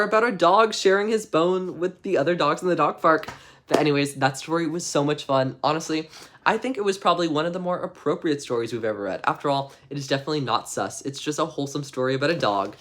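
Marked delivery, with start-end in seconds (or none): none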